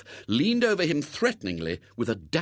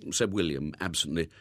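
plain reading voice

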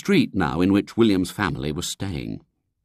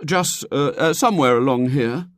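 put-on stalling, higher boyish hesitation; vague; 8 semitones higher, 4 dB louder than usual. A narrator's reading. William dodges giving his address, vague. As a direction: fumble for an answer as a boy. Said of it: "said William vaguely".

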